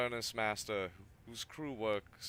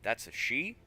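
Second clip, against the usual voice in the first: surprised; 6 semitones higher, 5 dB louder than usual. Real, not acted. Reacting to strange character.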